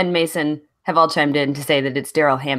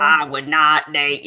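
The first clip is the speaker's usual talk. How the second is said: slow, laughing hobo drawl; sex hobo voice; 3 semitones lower than usual. Acted. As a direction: play a slow-talking hobo. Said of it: "why are you stealing my sex hobo voice".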